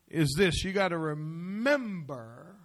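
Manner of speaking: ordinary delivery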